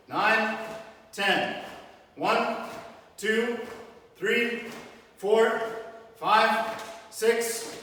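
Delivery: loud, sharp drill counts; counting out loud. Real, not acted. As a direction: bark each count for the punches. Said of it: "We're punching by my count".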